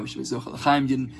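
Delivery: ordinary talk, nothing marked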